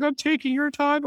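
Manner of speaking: high-pitched mock worry; retold apologetic fretting. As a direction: fret in a squeaky little voice. Retold worried apology in hallway.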